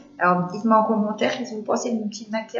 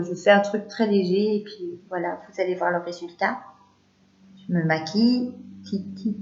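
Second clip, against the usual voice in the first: normal talking voice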